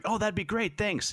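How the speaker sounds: high, bright mock girl voice, thrilled; chirpy ensign. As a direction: squeak it out like a delighted colleague. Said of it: "And she's like".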